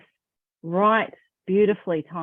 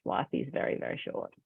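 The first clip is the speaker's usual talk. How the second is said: breathy whisper, much softer; 12 semitones lower, 10 dB quieter than usual. Acted. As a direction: drop to a hushed whisper to make it sink in. Emphatic aside on life's brevity.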